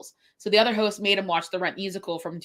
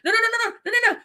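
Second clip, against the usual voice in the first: much higher rapid panicked no's; panicked pleading; 13 semitones higher, 3 dB louder, much faster than usual. Retold or imitated. Student begging the teacher not to write him up, high panicked. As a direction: beg frantically to stop her.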